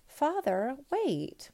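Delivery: high, urgent call; urgent plea. A narrator's reading. Princess halting emperor's sword, urgent plea. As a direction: plead for him to stop. Princess stops her father striking.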